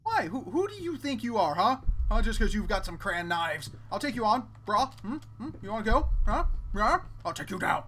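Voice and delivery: loud, high mock-tough taunts; taunting challenge. Real, not acted. Player taunting a knife-wielding creature, loud mock-tough challenge. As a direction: square up and taunt it, brah.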